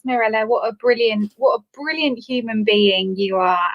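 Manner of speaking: ordinary delivery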